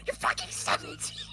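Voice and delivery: squealing high outraged yell; crashing out. Retold or imitated. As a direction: shriek it in disbelief. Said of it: "crashing the hell out".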